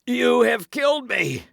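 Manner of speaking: very high, loud wail; anguished cry. A narrator's reading. Buckingham accuses his killer Felton, anguished cry. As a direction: wail it out as a mortal blow lands. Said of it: "cried Buckingham".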